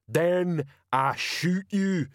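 troll sergeant announcing to the crowd; slow troll. A narrator's reading. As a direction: spell it out slowly like a dim troll.

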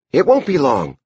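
put-on high child character voice; Edie. A narrator's reading. Girl promises her brother it is soon, high voice. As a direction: pitch it up like a little girl.

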